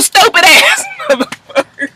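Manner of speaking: squeaky high laugh through the word; laughing insult. Real, not acted. Vlogger mocks fans, laughing high.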